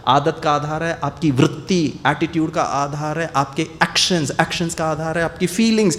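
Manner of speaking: normal talking voice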